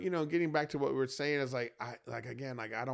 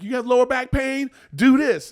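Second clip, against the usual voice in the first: loud TikTok doctor; 12 semitones higher, 12 dB louder than usual. Retold or imitated.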